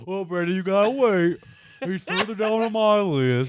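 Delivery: high, loud whiny mockery; mock the co-host's voice; mocking impression. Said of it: "What are you talking about".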